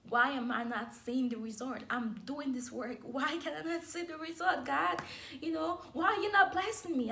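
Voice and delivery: praying to God after failed races, rising frustration; frustrated plea. Retold or imitated. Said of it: "I was like".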